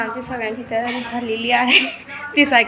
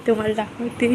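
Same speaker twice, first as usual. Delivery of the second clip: normal talking voice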